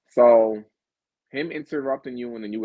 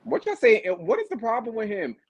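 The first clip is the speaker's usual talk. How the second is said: mock outrage; 12 semitones higher than usual. Host mock-outraged at the guest's "ew.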